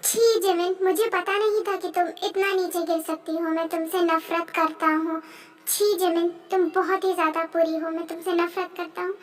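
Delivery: replayed words fading away; echoing memory. (यादों में गूंजती धीमी आवाज़)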